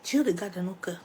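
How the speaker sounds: normal talking voice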